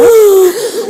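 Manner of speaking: sharp delighted gasp with a laugh; excited gasp. Real, not acted. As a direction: gasp in happy surprise. Fan greets her idol.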